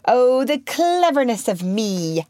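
high, drawn-out exultant crow; rapturous crowing. A narrator's reading. Peter boasts in wildest glee, crowing. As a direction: revel in yourself, slowly and rapturously. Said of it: "he crowed rapturously".

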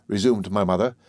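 ordinary delivery

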